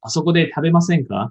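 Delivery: あそこで食べませんか said as a polite invitation; polite request. Shown as a demonstration. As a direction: offer it politely, like a courteous suggestion. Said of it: "the more polite way".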